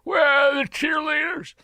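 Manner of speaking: put-on high, slow, drawn-out old politician voice; a hammed-up Southern ex-president shout; Bill Clinton impression. Mimics Clinton from the clip.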